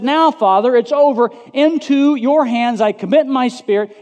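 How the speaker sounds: high, loud cry to the Father. Acted. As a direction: call out at full preaching force. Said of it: "in a loud voice".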